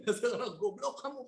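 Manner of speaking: mock her insult half laughing; mimicking the mother. Retelling the mother's insult to his friends, soft laughing mimicry. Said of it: "Dia bilang".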